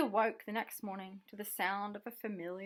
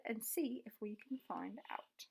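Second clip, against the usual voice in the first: whisper; 3 semitones lower, 9 dB quieter than usual. A narrator's reading. Frog proposes a secret climb.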